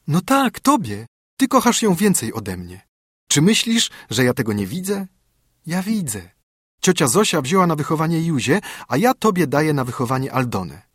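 put-on a child pressing a gift on her friend; high excited girl to her friend; eager little girl. Girl gives away her doll.

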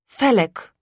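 ordinary delivery

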